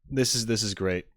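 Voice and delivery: ordinary delivery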